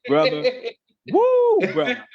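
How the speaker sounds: high, loud whooping woo; embarrassed whoop. Real, not acted. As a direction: let out a big flustered whoop. Recalling public embarrassment.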